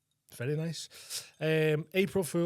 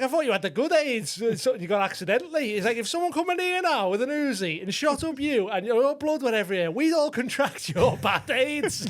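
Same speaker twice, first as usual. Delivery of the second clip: high, louder, comic outrage; mock outraged host; 9 semitones higher, 4 dB louder than usual. Sketch host scolding the man with the bad aids, mock outrage. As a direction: rant it in a high indignant voice.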